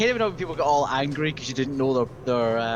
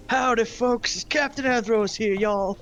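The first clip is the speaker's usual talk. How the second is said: higher, hammed-up Southern American accent; jokey American accent hello, y'all; Captain Andros in an American accent; 7 semitones higher, slower than usual. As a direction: greet like a cowboy in an American drawl. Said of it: "American accents".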